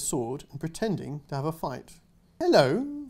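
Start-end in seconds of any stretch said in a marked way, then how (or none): 2.4-3.1 a small, shy, higher hello; timid little girl. Acted. Girl greeting a knight, timid and higher. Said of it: "the girl said timidly".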